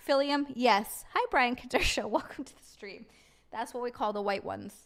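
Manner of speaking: ordinary talk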